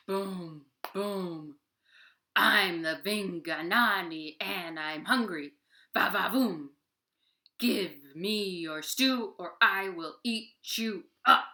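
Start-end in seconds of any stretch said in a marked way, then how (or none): 2.4-11.5 loud, slow monster growl demanding stew; booming hungry monster. Acted. Vinganani threatening Deer for his stew, loud slow monster voice. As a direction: boom it out like a greedy giant.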